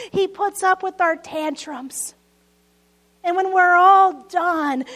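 ordinary delivery

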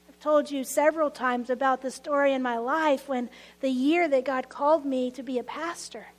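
usual speaking voice

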